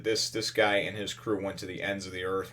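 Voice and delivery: ordinary talk, nothing marked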